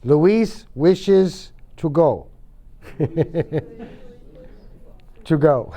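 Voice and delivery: wishes drawn out very slowly, every sound clear; slow model sentence. Shown as a demonstration. Teacher models wishes slowly for the class to repeat. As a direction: spell it out slowly for learners to copy.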